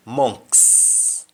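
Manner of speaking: "monks" slow, mongks; Patois monks. Shown as a demonstration. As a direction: stretch out "monks" slowly. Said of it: "In Jamaican, we say".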